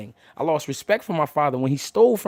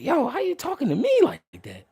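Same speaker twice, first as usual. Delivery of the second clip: offended retort; 8 semitones higher than usual. Retold or imitated. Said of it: "I'm like".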